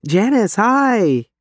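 bright, high call of hello; excited greeting. Acted. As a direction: greet a friend with delight. Greeting a friend across a cafe.

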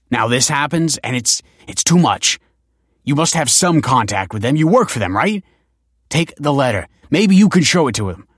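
desperate begging. A narrator's reading. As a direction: beg the director to pass on the letter. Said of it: "begged the man".

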